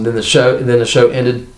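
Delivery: ordinary talk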